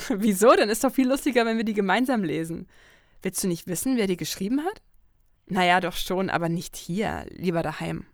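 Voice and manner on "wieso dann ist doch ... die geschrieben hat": bubbly, higher and louder, laughing into it; a giddy best friend pushing to read the letters together; Lucy, excited friend. Acted. Lucy urges her friend to open the letters, giddy and loud.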